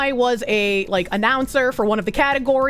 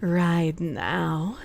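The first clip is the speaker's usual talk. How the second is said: low, slow and sultry; 4 semitones lower, 4 dB quieter, much slower than usual. Pretend. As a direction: purr the speech out slowly. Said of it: "read that Arise quote in, like, the fucking Findom voice".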